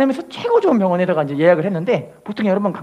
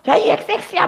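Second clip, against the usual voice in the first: higher mock-angry curse; imitating a furious mom swearing; mother cursing; 8 semitones higher than usual. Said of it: "막 욕을 하는 거예요".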